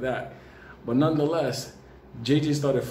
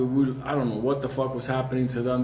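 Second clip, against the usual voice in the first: ordinary delivery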